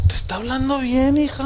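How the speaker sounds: high-pitched imitation of a mother; mother reasoning with daughter. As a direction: imitate mom correcting her girl, lightly. Mother voiced, soothing the daughter.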